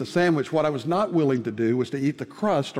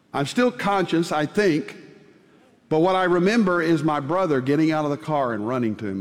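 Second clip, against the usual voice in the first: normal talking voice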